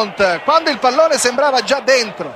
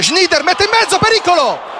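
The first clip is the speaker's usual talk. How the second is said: excited play-by-play; 7 semitones higher than usual. Real, not acted.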